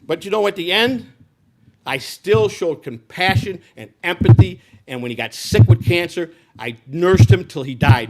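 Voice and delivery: real loud insistence. Real, not acted. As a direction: drive home his compassion, slowly. Insists on his compassion.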